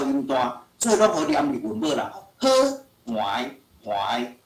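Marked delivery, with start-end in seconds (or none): 3.1-4.4 怀 said low and drawn, twice; sounding out. Shown as a demonstration. Teacher sounding out 怀 for the pupil. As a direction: sound out 怀 slowly for spelling. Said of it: "这是拼".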